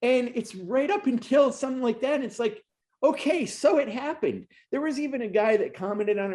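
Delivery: pleading that we want our guys to win; real emotion. Real, not acted. Host to rider, voice rising with real emotion. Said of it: "I'm getting a little emotional here".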